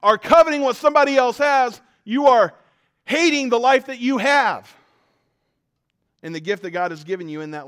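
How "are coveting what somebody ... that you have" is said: loud, high preaching push; forceful preaching. Acted. Pastor preaching hard at the congregation, loud and high. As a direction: drive the point home from the pulpit.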